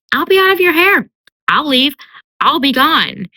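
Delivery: high, sing-song promise; coaxing promise. Retold or imitated. Feyre striking a deal with Lucien, coaxing. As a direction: sweeten the deal with a high lilt.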